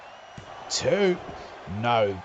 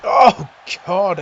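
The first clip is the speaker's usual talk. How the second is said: loud, higher shouted call; 5 semitones higher, 11 dB louder than usual. Acted. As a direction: yell it out at the big hit. Big move lands.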